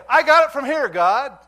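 high, very loud mock boast; shouted self-assured dismissal, acted out; cocky shout. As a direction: call it out to God, brashly overconfident.

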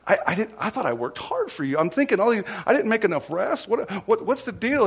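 rising mock disbelief, tripping over words; bewildered protest. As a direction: act out being thrown and baffled. Acts out his bewildered reaction to toast.

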